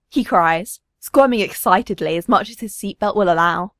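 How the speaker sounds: ordinary delivery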